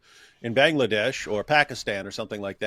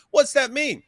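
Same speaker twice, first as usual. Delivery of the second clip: much higher, louder demand; incredulous; 13 semitones higher, 5 dB louder than usual. Real, not acted. Asking the host what the story implies, loud incredulous. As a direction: press the question in disbelief.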